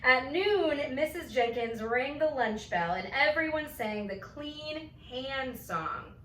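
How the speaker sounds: ordinary delivery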